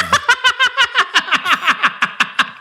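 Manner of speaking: long, high peals of laughter; helpless laughter. Real, not acted. Teasing co-host about identical names, high helpless laughter. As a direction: crack up in high-pitched laughs.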